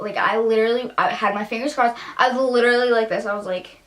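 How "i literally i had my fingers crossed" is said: ordinary delivery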